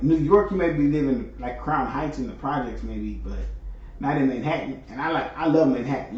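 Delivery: ordinary talk, nothing marked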